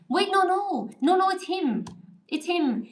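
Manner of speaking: high, quick no no, catching a mistake; urgent self-correction. Real, not acted. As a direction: stop yourself mid-thought and fix it. Correcting herself in a hurry.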